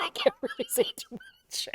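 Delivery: a secretive aside, fully whispered. Real, not acted. Whispering a cheeky aside to her co-host about Mussolini. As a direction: whisper it like a forbidden joke.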